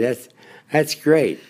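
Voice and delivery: mock Baltimore cabbie delight; Baltimore cabbie accent. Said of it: "I loved the Baltimore accent".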